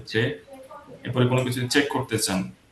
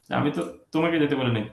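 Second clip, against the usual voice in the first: usual speaking voice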